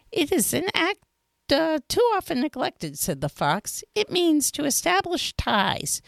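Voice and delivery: fox character voice. A narrator's reading. Fox tells the little prince about men, in a high fox voice. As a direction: play the sly fox complaining about hunters.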